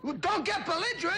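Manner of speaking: mock indignant. Mock scolding the apologizing partner.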